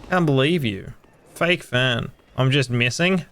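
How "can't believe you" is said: ordinary talk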